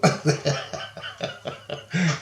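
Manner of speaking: long, voiceless chuckle; amused laugh. Real, not acted. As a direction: laugh at the memory of their freak-out. Laughs at scientist freaking out.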